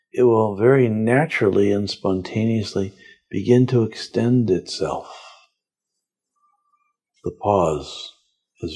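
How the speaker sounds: slow, calm meditation guide voice; guided meditation. Acted. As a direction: lead them gently inward, unhurried.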